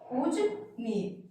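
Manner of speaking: पूजनीय spoken very slowly and softly as पूज-नीय; slow correct pronunciation. Shown as a demonstration. Teacher softly models the correct word for students. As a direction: draw out पूजनीय gently, no extra syllable. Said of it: "यहाँ पर हो जाएगा पूज".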